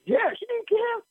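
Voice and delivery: amused high reply. Retold or imitated. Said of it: "I said".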